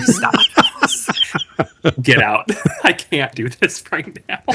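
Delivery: retold protest breaking up with laughter; laughing mock protest. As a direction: laugh helplessly through a mock refusal. Laughing through retold reaction to voice.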